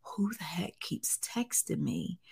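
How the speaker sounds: hushed whisper to herself; whispered aside. Retold or imitated. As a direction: whisper it so the camera can't hear. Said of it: "So I'm like".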